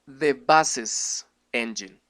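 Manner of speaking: buses with an E sound before the S. Shown as a demonstration. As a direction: buses for bus's. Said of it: "se pronuncia".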